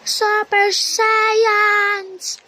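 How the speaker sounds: slow, high, loud proclamation; dramatic announcement. Pretend. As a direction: drag out super science grandly.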